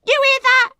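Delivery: very high, loud shriek; outraged piercing yell; scream. A narrator's reading. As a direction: scream it for everyone to hear. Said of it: "Rosie screamed".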